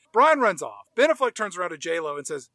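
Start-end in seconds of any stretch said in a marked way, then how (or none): none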